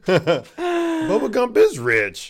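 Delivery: high, slow words through laughter; laughing. Real, not acted. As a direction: say it while cracking up, drawn out. Cracking up during banter.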